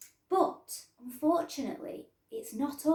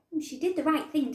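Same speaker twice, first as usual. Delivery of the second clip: ordinary delivery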